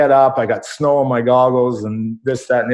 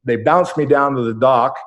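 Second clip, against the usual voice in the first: ordinary talk, nothing marked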